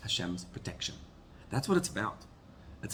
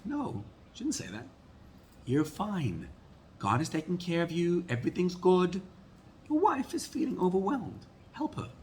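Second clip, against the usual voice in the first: higher, lightly voiced reassuring self-talk; calm inner voice; 9 semitones higher than usual. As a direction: talk yourself down, pitched up. Calming inner voice speaking.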